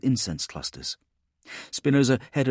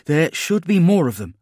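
higher, louder tense warning; urgent commander; 9 semitones higher, 7 dB louder than usual. Acted. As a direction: think aloud under pressure. Spinoza reasons while running.

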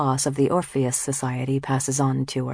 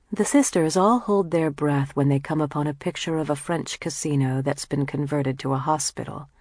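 ordinary delivery, nothing marked

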